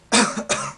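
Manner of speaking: short forced cough from the stomach; demonstrative cough. Shown as a demonstration. Teacher showing the push reflex, deliberate cough. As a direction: cough on purpose. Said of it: "So you go to cough".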